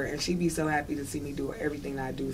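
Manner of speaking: ordinary delivery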